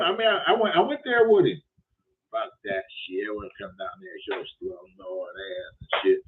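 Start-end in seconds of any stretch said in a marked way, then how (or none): 2.3-6.2 low, soft mock of the caller's dismissive voice. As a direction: mutter it like the grumpy caller.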